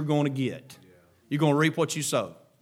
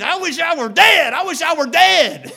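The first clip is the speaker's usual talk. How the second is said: higher, louder despairing moan; Jonah through gritted teeth; 13 semitones higher, 10 dB louder than usual. Acted. Jonah on the hillside wishing he were dead, gritted teeth. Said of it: "gritting his teeth".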